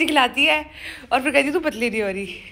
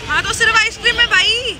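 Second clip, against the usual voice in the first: high, loud defensive protest; indignant protest; 10 semitones higher, 5 dB louder than usual. Real, not acted. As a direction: insist it's only ice cream, voice up. Defending her ice cream.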